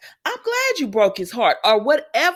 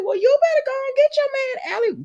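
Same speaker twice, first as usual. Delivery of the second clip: high squealed hype; high thrilled cheering; excited cheering. Real, not acted. Cheering character on.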